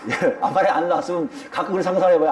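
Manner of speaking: usual speaking voice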